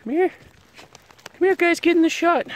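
very high, squeaky coaxing; cutesy pet call. Real, not acted. Vlogger calling puppies into the shot, high squeaky voice. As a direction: coax them in a tiny high voice.

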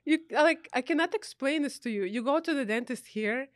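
ordinary talk

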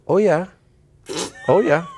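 high, polite listening noise; Canadian women's "oh, yeah". Shown as a demonstration. Canadian woman showing interest as she listens, high "oh, yeah". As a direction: chirp it high, as an attentive listener. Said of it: "there's another thing, too, of Canadian women".

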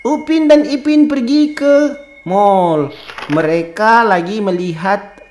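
sing-song bedtime tale; cheerful storytelling. Acted. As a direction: read it brightly to a baby. Reading fun storybook to baby.

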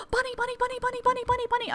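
very high, rapid bunny chant; excited squeal. Real, not acted. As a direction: squeal it like you just saw a bunny. Spots a wild rabbit in game.

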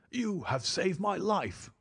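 high, slow voice of a rescued man; shaken baronet. A narrator's reading. Baronet thanks the men who rescued him, high shaken voice. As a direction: say it high and slowly, still shaken.

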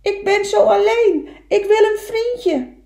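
high, loud plaintive cry; calling out. Acted. Lonely little beaver calling for a friend, loud high call. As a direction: cry it out across the water. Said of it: "en toen riep hij".

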